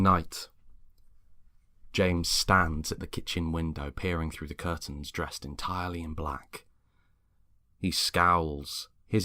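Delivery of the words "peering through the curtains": ordinary delivery, nothing marked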